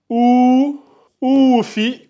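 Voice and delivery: long held "ou", then short "fi"; voyelle allongée. Shown as a demonstration. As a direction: stretch ou on a steady note before fi. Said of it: "on l'allonge en deux secondes". (« ou » allongé, puis « fi » bref)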